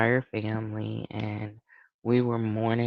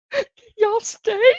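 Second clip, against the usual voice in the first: laughing plea; 7 dB louder than usual. Retold or imitated. Begging helpers to stay.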